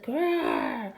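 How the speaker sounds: short storybook tiger growl. A narrator's reading. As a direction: answer with a rolling growl. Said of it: "the tigers only answered".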